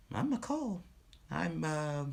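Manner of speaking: high-pitched put-on co-host; co-announcer's voice. Co-announcer introducing herself to the school, high put-on voice. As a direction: pipe up as the other announcer.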